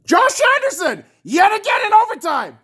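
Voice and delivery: high-pitched furious yell; outraged shout. Real, not acted. Fan furious at the overtime loss, outraged high shout. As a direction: shout the answer in real outrage. Outraged answer to own question.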